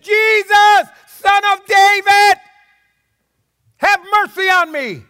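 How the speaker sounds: high, full-voiced desperate shout. Blind man shouts past the townspeople to Jesus, desperate yell. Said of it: "all the louder".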